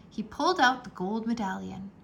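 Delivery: plain reading voice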